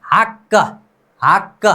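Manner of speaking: हक said as "hak-ka", with a released doubled k; pronunciation demo. Shown as a demonstration. Teacher shows learners the word, pronunciation demo. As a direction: sound out हक in two beats, hak-ka. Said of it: "अब पहले इसका उच्चारण करते हैं".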